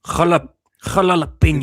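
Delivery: Jalalapenos with a Spanish j; Spanish accent. Shown as a demonstration. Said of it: "Spanish accent?".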